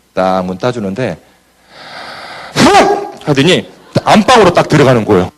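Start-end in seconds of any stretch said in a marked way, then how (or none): 2.5-3.3 sharp high startled gasp; wife gasping at a salesman on her doorstep; shocked gasp. Retold or imitated. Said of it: "그대로를 제가 재현할게요".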